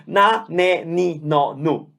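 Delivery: na ne ni no nu with pure open vowels; Italian vowels, not English. Shown as a demonstration. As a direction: keep every vowel clean, no English glide. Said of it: "without the English sounding vowels".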